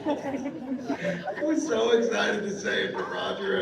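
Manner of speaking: high, drawn-out words through laughter; helpless laughter in the voice; real laughing. Real, not acted. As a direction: struggle to talk while cracking up. Cracking up at own story.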